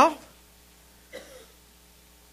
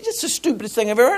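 fast scornful retort; 3 semitones higher, 3 dB quieter, much faster than usual. Retold or imitated. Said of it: "I said".

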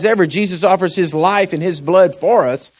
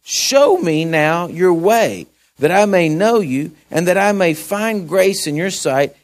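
raised, measured Bible reading; scripture reading; 5 semitones higher, 4 dB louder, slower than usual. Acted. Pastor reads Moses' prayer to the congregation, measured and raised. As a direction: read the passage with weight.

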